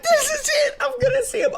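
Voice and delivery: very high, giddy squeal; excited anticipation. Retold or imitated. Streamer about to walk in on friends, acted giddy excitement. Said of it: "thinking they're gonna be there".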